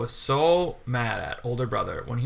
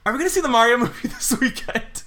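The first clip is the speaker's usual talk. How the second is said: put-on excited kid voice; 9 semitones higher than usual. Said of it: "every child when they got picked up were asking their parents".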